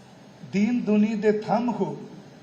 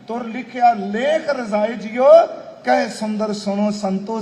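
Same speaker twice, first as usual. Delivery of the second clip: louder, stretched verse recital; scripture recitation; 3 semitones higher, 4 dB louder than usual. Acted.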